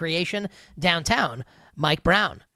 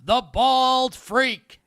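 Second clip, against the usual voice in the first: slow, stretched, high announcer shout on bald; imitating Russ; 6 semitones higher, 4 dB louder, much slower than usual. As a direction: copy the usual sounder, dragging "The bald freak". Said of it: "like the way Russ normally does it".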